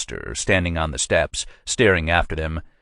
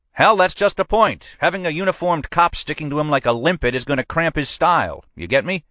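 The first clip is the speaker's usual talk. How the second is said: higher-pitched, pushy precinct captain; a captain pressing his man, voiced higher than the narrator; police captain; 11 semitones higher than usual. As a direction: lean on your sergeant like a boss who wants results. Captain explains plainclothes.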